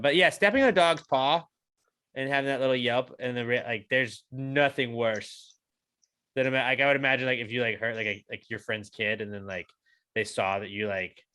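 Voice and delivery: ordinary talk, nothing marked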